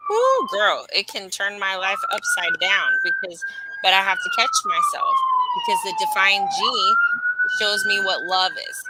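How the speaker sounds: high, loud whooping excitement; excited squeal. Acted. To the listener about a defined G, excited squealing. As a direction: squeal it out like you're swept away.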